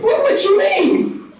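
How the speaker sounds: shocked squeal. Retold or imitated. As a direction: shriek it like Dorothy just learned the truth. Dorothy shocked at the news.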